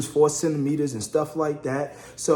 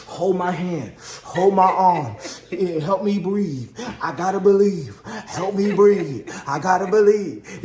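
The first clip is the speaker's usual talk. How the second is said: loud, rhythmic mock pleading; acted-out urgent begging in even bursts; imitating labour pleas; 3 semitones higher, 3 dB louder than usual. Wife in labour, practicing breathing.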